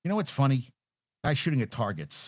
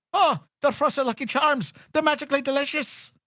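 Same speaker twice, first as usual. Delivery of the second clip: much higher, put-on cereal ad leprechaun voice; Lucky Charms leprechaun; 4 dB louder than usual. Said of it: "Yeah, I'm sorry about that".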